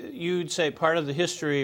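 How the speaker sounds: normal talking voice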